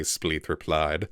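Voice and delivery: ordinary delivery, nothing marked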